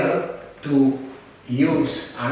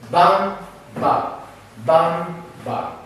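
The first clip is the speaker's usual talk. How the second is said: rhythm vocalising; 3 semitones higher, much slower than usual. Shown as a demonstration. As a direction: sing out the strong and weak beats. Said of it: "Strong, pa, strong".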